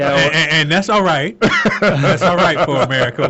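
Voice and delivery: laughing through the words; chuckling as he speaks. Real, not acted. Joking about American culture.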